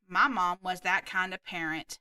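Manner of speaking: plain reading voice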